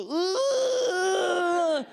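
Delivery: long, loud, high "ooh"; imitated alarmed wail. British queue freaking out at a queue-jumper, mock alarm. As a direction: hold one high, panicked note. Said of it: "people just go".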